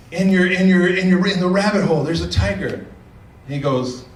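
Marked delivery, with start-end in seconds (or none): 0.1-2.9 higher and louder, stumbling in panic; frantic monkey. Acted. Monkey frantically telling the rabbit about the tiger, panicked. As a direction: blurt it out, tripping over words.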